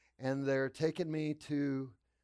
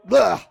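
loud retching noise of disgust; disgusted bleh; 6 semitones higher, 20 dB louder than usual. Acted. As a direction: blurt out a yuck at an awful taste. Mimes disgust at diet milkshake taste.

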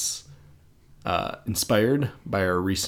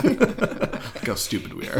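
laughing; 4 semitones higher than usual. Retold or imitated. Mock statement, laughing.

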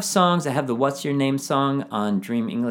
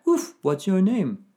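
higher playful dog puppet voice; 7 semitones higher than usual. Pretend. Teacher voicing a dog puppet for young learners, higher and playful. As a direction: bark out the question like a cheerful puppy. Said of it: "Maybe a dog and a cat".

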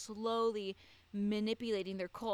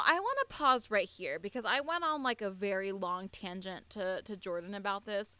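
ordinary talk

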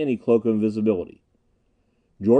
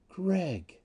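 high, soft, husk gone; soft woman's voice; 7 semitones higher, 8 dB quieter than usual. A narrator's reading. Anita drops her disguise to Gregg, soft pleading. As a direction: breathe the name high and gently. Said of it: "A different voice, its throaty, husky quality gone".

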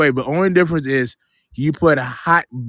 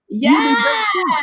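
mock wail. Real, not acted. Nostalgic complaint about oxtail prices.

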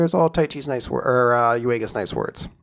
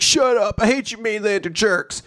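mock angry outburst; 7 semitones higher than usual. Voices a kid's angry retort.

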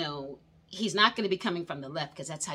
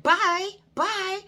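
dismissive goodbye; 11 semitones higher, 6 dB louder than usual. Retold or imitated. Dismissive farewell, retold.

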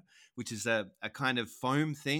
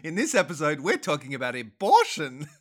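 mock announcer; 3 semitones higher, 6 dB louder than usual. Mock podcast intro announcement.

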